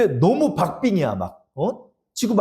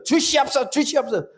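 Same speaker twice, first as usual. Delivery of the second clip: higher, whiny begging prayer; mock whiny begging; 9 semitones higher than usual. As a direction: beg like a greedy child. Mocking greedy prayer.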